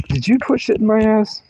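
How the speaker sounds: high-pitched mock accusation; mom's incredulous question, hammed up; imitating mom. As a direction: squeal it as mom grilling a suspect. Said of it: "That's how my mom talks".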